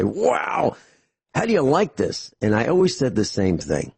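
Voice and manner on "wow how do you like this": high-pitched imitation of impressed bystanders; amazed onlookers. People at a gas station admiring his car, imitated amazement. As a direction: mimic gawkers with a drawn-out, higher "Wow". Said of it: "people would say".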